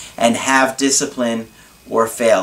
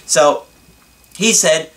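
ordinary talk

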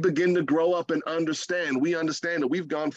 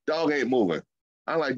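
ordinary delivery

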